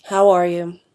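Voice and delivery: flat, toneless greeting; melancholy, bored. Shown as a demonstration. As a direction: greet them as if too bored to care. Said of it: "more melancholy or kind of bored, their, their tone becomes a lot flatter".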